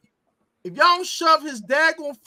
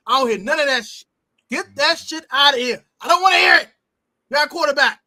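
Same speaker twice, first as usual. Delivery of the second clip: emphatic rant. Real, not acted. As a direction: hammer each point, no arguing. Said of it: "I'm gonna say this emphatically".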